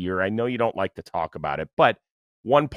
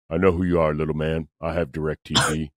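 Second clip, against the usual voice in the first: Shaq impression; 3 semitones lower than usual. Shaq answering the reporter's introduction, booming deep impression. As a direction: drop deep and boom it out like a giant. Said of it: "that was a good little impression too".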